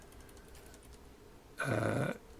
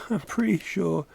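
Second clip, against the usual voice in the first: amused, higher laughing repeat; 7 semitones higher than usual. Real, not acted. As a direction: laugh through the words. Laughing repeat of own claim.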